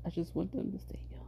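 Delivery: soft breathless wish; whisper. Real, not acted. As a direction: whisper it to yourself. Sad about kids growing.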